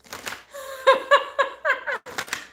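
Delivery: high, bright giggling laugh; burst of laughter. Real, not acted. Laughing at chat saying she sounds Southern, high giggle. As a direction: crack up laughing at the comment.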